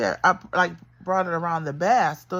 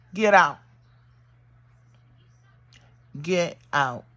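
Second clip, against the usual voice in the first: retold order; 4 dB louder than usual. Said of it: "I told her just like this".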